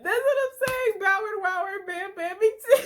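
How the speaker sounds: very high, loud squeal of amusement; crying with laughter. Real, not acted. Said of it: "I'm crying now".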